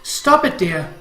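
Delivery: alarmed mother, voice leaping up; distressed protest. Acted. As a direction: cry out to stop him. Mother cries out to stop him.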